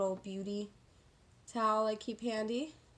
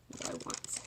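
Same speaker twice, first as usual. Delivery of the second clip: whispering the question to herself; breathy whispered self-question; 6 dB quieter than usual. Real, not acted. Musing to herself over brushes.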